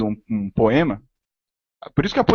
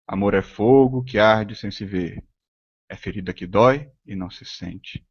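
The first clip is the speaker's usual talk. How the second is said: slow, measured reading of the verse, pausing between phrases; slow recitation; 4 semitones lower than usual. Shown as a demonstration. Teacher showing how many tones fit a Camões line, slow recitation. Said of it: "em quantos tons você pode falar isso".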